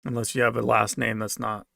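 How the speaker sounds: normal talking voice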